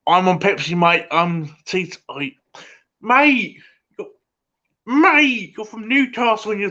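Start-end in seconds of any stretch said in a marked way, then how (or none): 3.0-6.7 mock outrage. Mock outrage at teetotal viewer.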